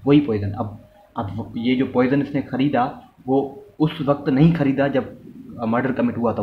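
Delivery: normal talking voice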